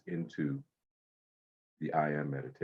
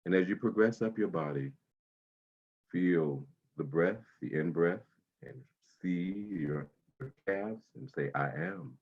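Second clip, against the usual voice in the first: guided meditation. Acted. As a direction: ease them into calm breathing. Guides body-scan meditation.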